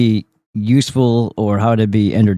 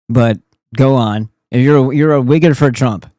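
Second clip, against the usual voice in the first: normal talking voice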